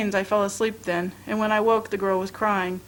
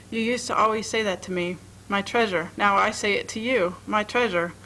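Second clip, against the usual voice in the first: plain reading voice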